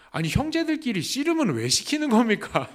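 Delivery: high, loud comic complaint; indignant mock grumble; mock protest. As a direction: protest in playful exasperation, on the edge of laughing. Comic protest at childhood wrestling requests.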